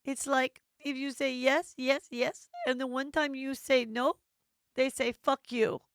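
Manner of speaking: high put-on voice, slowing on the last words; imitating Juliana. Host quoting Juliana's wisdom about saying no, high mimicking voice. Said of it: "She goes".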